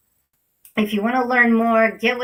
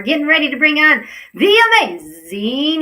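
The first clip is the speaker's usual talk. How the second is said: loud, high, swooping build-up; announcer fanfare; 6 semitones higher, 8 dB louder than usual. Real, not acted. Host bringing on her guest, big announcer fanfare. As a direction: belt out a show introduction.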